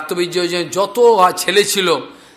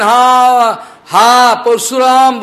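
drawn-out, louder wail of grief; wailing cry; 4 semitones higher, 5 dB louder, much slower than usual. Retold or imitated. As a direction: cry the name out slowly from far away. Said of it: "বলে চিৎকার করছেন". (দূর থেকে ধীরে টেনে করুণ চিৎকার)

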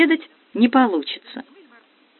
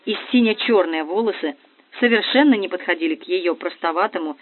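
plain reading voice

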